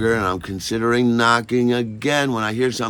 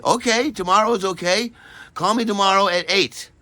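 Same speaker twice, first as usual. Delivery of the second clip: Maggie's voice, imitated; 11 semitones higher, 4 dB louder than usual. Maggie answering Harry, voiced higher.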